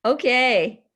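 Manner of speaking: normal talking voice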